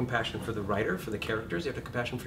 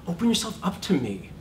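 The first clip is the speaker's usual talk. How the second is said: pleading demand; 7 semitones higher, 4 dB louder than usual. Shown as a demonstration. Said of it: "you go".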